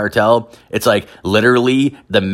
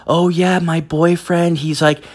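mock higher girly voice, lightly done; a hint of a gossiping young woman; imitating girlfriend's friend; 7 semitones higher than usual. Friend telling the deportation news, mock higher voice. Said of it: "She's like".

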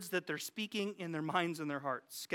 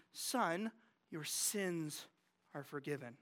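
softer and lower, gentle pronouncement; tender Jesus; 3 semitones lower, 6 dB quieter, slower than usual. A narrator's reading. Jesus speaks forgiveness to the paralyzed man, softly. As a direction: let the forgiveness land quietly. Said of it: "He looks on them and he says".

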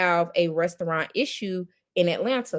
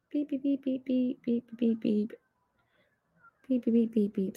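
bomb timer beeps; 4 semitones higher, 6 dB quieter than usual. Pretend. Sings beeps during a pause.

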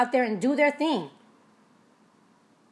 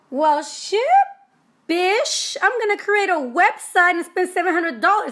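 sassy resignation; 6 semitones higher, 3 dB louder, slower than usual. Retold or imitated. Retold resigned reply to coach.

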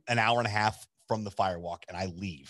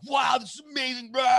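high-pitched gushing outburst; over-excited raving; 14 semitones higher than usual. Retold or imitated. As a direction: gush wildly, hammed-up. Excited text after firewalk.

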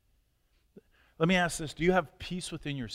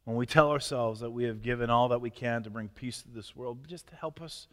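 fervent, rising pastoral prayer voice; earnest prayer; 2 semitones higher, 7 dB louder than usual. Acted. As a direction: pray aloud for the congregation, heartfelt. Pastor leads closing prayer.

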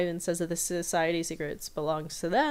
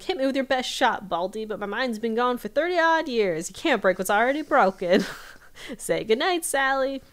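put-on higher and louder cocky old-man taunt; taunting McGucket; 6 semitones higher, 5 dB louder than usual. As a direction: goad the villain with gleeful bravado. Voicing McGucket taunting Ivan.

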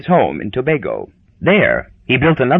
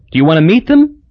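eager Hazel; 5 semitones higher, 4 dB louder, faster than usual. Acted.

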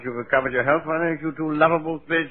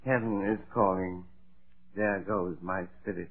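softer, faint dying whisper-like delivery; feeble deathbed line; 5 semitones lower, 7 dB quieter than usual. Pretend. Faking death, Topper murmurs his cue faintly. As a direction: let the cue fade out weakly. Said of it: "all you have to do is say".

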